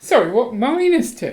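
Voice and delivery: incredulous minus two, rising high; disbelieving, pitch shooting up; surprised. Real, not acted. Shocked at room rating.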